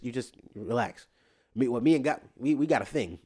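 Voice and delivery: ordinary talk, nothing marked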